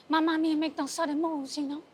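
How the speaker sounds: ordinary talk, nothing marked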